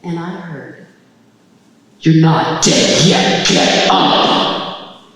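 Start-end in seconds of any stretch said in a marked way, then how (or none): 2.0-5.0 huge low, slow thundering voice; booming command. Retold or imitated. Voice from the heavens answering her scream, booming command. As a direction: boom it out like a voice that shakes the house.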